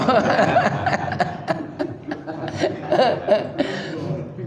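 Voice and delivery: breathy quiet laugh. Real, not acted. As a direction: chuckle at the joke. Laughs at his own joke. (tertawa kecil)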